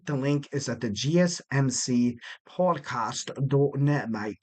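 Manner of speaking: slow, hammed-up bad Australian accent, ending on a low "mate"; a mock Australian accent, slowed down; terrible Australian accent. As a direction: put on a clumsy Australian accent and drag it out. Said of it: "that was a terrible Australian accent".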